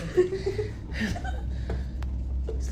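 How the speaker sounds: high, breathy nervous laugh. Real, not acted. Shaken mother laughing nervously at the haunting. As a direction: laugh off the fright.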